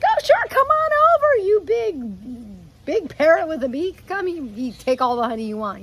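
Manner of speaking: squeaky high, hammed-up welcoming bee; mock bee voice. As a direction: play a silly overly generous bee, very high. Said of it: "I don't think bees would be like".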